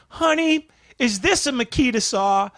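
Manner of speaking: overacting for the camera; cheesy commercial actor. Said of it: "and he's like".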